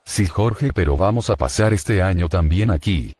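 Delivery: voz de otro personaje, mucho más grave; quejándose con voz grave de personaje. Acted. Otro personaje se queja de gastos. (personaje quejoso de voz grave)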